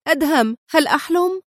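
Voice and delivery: high-pitched happy wonder; joyful, yearning. A narrator's reading. Woman recognises her rescuer, overjoyed and yearning. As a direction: call out in eager, disbelieving joy. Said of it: "في سعادة ولهفة".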